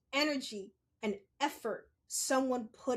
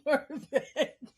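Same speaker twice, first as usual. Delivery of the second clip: knowing scold; 4 semitones higher, 7 dB louder than usual. Retold or imitated. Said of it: "you're like".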